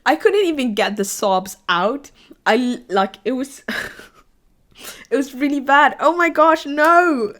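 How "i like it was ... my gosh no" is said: laughing. Real, not acted. Recalling sobbing over the anime.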